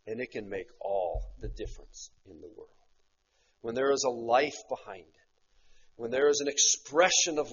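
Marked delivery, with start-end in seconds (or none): none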